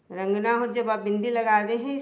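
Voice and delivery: normal talking voice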